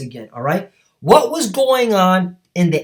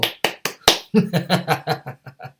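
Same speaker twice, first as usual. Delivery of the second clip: a short scornful laugh, held back; mocking laugh; 3 dB quieter than usual. Real, not acted. Preacher scoffing at America's blunder, laughing. Mocks America's foreign policy.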